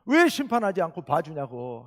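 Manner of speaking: loud, indignant protest to God; Jonah's angry outburst. Retold or imitated. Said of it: "성질을 있는 대로 내면서".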